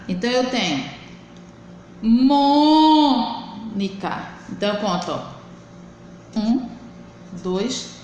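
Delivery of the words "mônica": "Mônica" with a very long MÔ; slow MÔÔÔ-nica stress demo; stressed syllable drawn out. Shown as a demonstration. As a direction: hold the first syllable of "Mônica", then drop quickly through NICA. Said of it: "sílaba mais forte da palavra".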